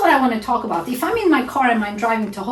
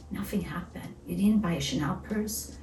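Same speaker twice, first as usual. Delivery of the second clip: ordinary delivery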